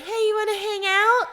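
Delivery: high, singsong faux-innocent ask; retold breezy invite in a high voice; mock casual invitation. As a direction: pitch it way up, playfully nonchalant. Retold casual text after scare.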